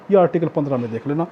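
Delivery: ordinary talk, nothing marked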